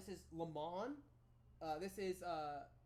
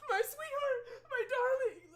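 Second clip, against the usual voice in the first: high, loud, sobbing with joy; weeping mother; 11 dB louder than usual. Acted. Queen weeping over her returned daughter, high sobbing cry. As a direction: cry out through tears of relief.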